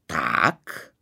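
low, menacing single word; dreadful voice. A narrator's reading. Pepek menacing the bride's accuser in a low voice. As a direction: growl the question like a threat. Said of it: "otázal se Pepek děsným hlasem".

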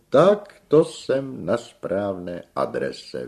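high, loud, stretched-out delight; theatrical triumphant exclamation; exclaiming tenor. A narrator's reading. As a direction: cry it out grandly, word by word. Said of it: "vykřikl zpěvák".

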